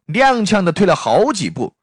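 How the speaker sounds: ordinary delivery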